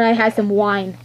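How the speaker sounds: usual speaking voice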